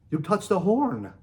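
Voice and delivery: a light hint of a French accent. Robin tells the narrator he touched a horn, light French accent. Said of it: "says in a thick French accent".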